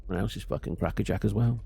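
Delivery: usual speaking voice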